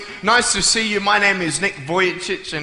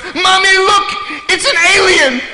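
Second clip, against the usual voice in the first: put-on very high, loud shrieking girl; frightened little girl; 13 semitones higher, 7 dB louder than usual. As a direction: scream it to mom like a scared little girl. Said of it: "and she goes".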